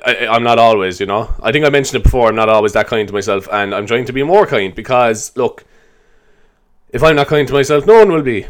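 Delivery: ordinary delivery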